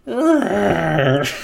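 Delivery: shrill screeching dinosaur cry. Pretend. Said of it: "my, my dinosaur noise".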